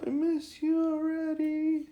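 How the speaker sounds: high, drawn-out mock howl; whining, howling puppy. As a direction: whine it slowly like a lonesome puppy. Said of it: "she starts whining and howling and go".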